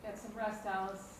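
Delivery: soft, low-key bedtime murmur; hushed, soothing. Acted. Telling Alice to get some rest, hushed and soothing.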